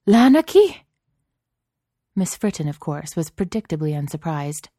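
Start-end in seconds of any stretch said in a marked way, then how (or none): 0.1-0.8 very high, loud outcry; shrieked French-teacher outburst; French exclamation. A narrator's reading. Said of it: "Miss Maupassant exclaimed".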